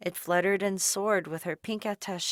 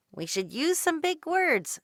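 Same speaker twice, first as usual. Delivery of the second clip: shouting to Dot; 9 semitones higher than usual. A narrator's reading. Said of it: "she shouted to Dot".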